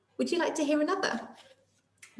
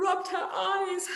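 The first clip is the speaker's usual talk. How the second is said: lively storybook reading voice; reading to children; 4 semitones higher than usual. A narrator's reading. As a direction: read it out like a picture book for little ones. Reading a picture book aloud to children.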